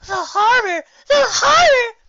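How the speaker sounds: high, loud panicked cries rising; flower pony crying in alarm, rising shriek. Acted. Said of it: "cried a pale earth pony".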